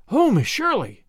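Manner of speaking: put-on high, delighted child's call; excited boy greeting his teacher; excited cry. A narrator's reading. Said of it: "he cried excitedly".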